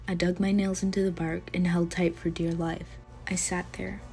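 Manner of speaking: ordinary delivery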